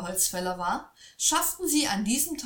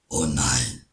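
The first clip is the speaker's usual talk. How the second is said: very low, begging troll; troll begs the boy not to take his well, very low; pleading troll; 5 dB louder, slower than usual. A narrator's reading. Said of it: "flehte ihn der Troll an".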